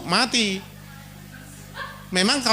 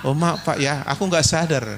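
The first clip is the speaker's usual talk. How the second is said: gentle apology; 6 semitones lower than usual. Acted. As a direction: apologise sweetly and low. Wife apologizes to husband.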